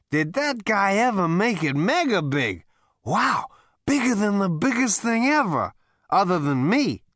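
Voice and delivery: high, loud, brash Zaphod; boastful high party voice. A narrator's reading.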